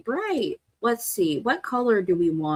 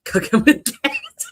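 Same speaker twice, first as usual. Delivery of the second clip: much higher and slow, squealing; squeaky excited; 6 dB louder, slower than usual. Acted. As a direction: squeal the phrase with glee. Echoes cohost excitedly.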